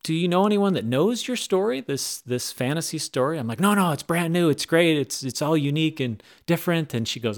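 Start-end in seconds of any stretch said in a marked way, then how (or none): 0.0-3.4 higher-pitched, politely hesitant question. Retold or imitated. Screenwriter gently questioning a newcomer about the fantasy screenplay, polite. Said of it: "she was very polite". 3.6-6.9 higher, louder, eager insistence; eager reassurance. Retold or imitated. Newcomer defending the brand new story to screenwriter, eager.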